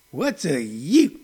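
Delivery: slow, puzzled Southern judge. Retold or imitated. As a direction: drawl it like a stern judge. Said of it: "He goes".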